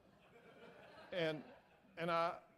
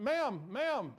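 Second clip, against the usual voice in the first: raised, higher calling-out to catch someone; hollering; 7 semitones higher, 5 dB louder than usual. Retold or imitated. Man running up behind a lady to stop her, hollering. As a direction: call after a person walking away. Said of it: "I'm hollering at her".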